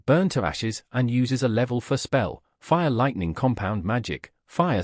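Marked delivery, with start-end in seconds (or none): none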